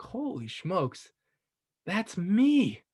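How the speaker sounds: slow hushed holy smokes, then a high burst on that's me; astonished realization. Retold or imitated. Recognizing herself in the water, astonished. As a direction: let the recognition dawn and break.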